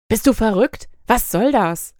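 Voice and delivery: loud, high outburst of disbelief; a man shouting in alarm at a sudden advance; shocked shout. A narrator's reading. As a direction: yell it at her, caught off guard. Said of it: "rief er".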